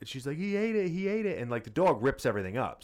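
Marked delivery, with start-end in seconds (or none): 0.4-1.4 put-on high, insistent kid voice; a light imitation of a child tattling; upset child. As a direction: repeat it like a wronged little girl. Daughter repeating upset.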